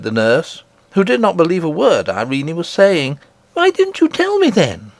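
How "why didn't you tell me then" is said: put-on an eager child telling a wonder; little princess. Princess telling nurse of her grandmother, high and eager.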